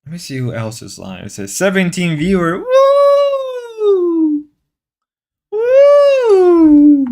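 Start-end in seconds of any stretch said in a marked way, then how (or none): 2.6-7.1 very high, drawn-out woo; excited whoop. Real, not acted. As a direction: whoop it high and loud for the viewer count. Celebrates seventeen viewers.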